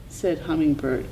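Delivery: usual speaking voice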